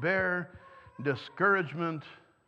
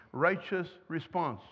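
ordinary delivery

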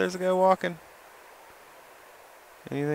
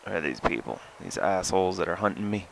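ordinary talk, nothing marked

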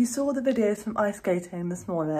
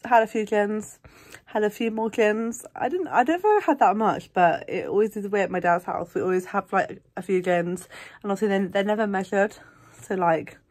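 ordinary delivery

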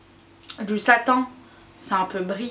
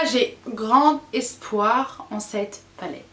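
ordinary talk, nothing marked

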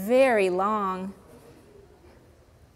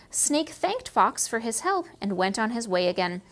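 ordinary delivery

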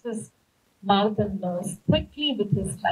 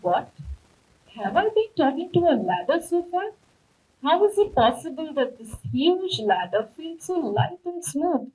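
surprised; 6 semitones higher than usual. Acted. Shekhar realises he talked to ladder.

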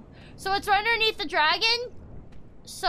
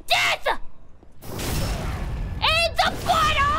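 triumphant yell; 7 semitones higher than usual. Real, not acted. Killing enemy, triumphant yell.

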